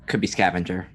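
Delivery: ordinary talk, nothing marked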